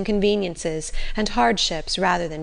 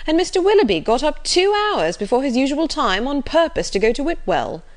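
animated, raised-pitch protest; remonstrating Sir John; 7 semitones higher, 4 dB louder than usual. Acted. Sir John urging Brandon to think better, remonstrating. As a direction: argue the case with emphatic high peaks.

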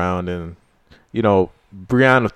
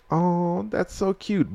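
higher, soft mock-sweet oh that's so cute; mock cooing; 6 semitones higher, 8 dB quieter, much slower than usual. Friend acting Arya's pitying look, higher soft coo. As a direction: lightly coo in pity. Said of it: "she looked at him like".

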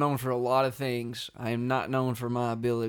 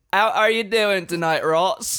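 put-on British accent; 5 semitones higher, 6 dB louder than usual. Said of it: "She laughed into it".

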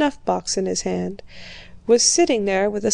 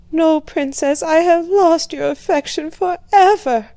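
tearful plea; 7 semitones higher, 4 dB louder than usual. A narrator's reading. Bourienne weeping, fears lost affection.

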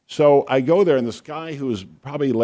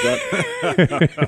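laughing through the question, slowed and high. Real, not acted. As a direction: giggle it out slowly. Teasing co-host about stories.

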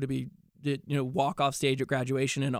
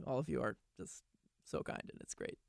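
choked-up near whisper; 2 semitones lower, 15 dB quieter than usual. Real, not acted. Actor thanking his castmates, voice sinking to a moved near whisper. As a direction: voice dropping away, almost a whisper, moved.

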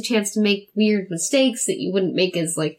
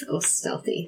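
whisper; 8 dB quieter than usual. Pretend. Jokingly says stealthy stealthily.

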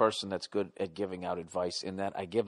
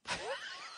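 laugh; 12 dB quieter than usual. Real, not acted. Laughs at own joke.

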